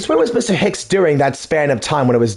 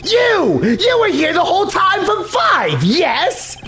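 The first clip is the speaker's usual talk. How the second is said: accusing shout; 12 semitones higher than usual. Acted. Character accuses the man.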